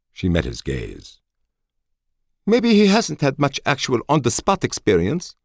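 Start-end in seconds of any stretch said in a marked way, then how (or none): 2.4-5.3 high, loud commanding officer voice; crisp female officer, firm and high; female colonel voice. A narrator's reading. Colonel Schoen voiced by narrator.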